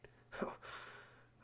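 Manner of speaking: short unsettling laugh under the breath; creepy low chuckle. Acted. Mocking her new dance.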